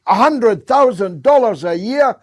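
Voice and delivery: HUNDRED THOUSAND DOLLARS punched high and loud; indignant emphatic repeat. Real, not acted. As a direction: hammer each word of "hundred thousand dollars".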